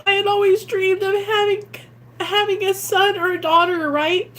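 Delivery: high, tearful voice on the edge of crying; fighting back real tears as she speaks; getting emotional. Real, not acted. Recovering mother telling viewers about her son, real tears. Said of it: "I don't mean to get emotional".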